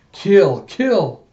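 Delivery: two loud, rising cries; acted frenzied outburst; bloodthirsty urging. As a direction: urge on the killing. Villain's monologue peaks.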